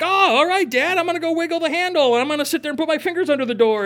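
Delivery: put-on kids' voice. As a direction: play the cheeky kid at the door. Kids answering dad, voiced.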